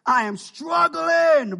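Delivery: drawn-out, loud groaning cry; retold shout of struggle; mock anguished shout. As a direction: belt it out slow like you're at the end of your rope.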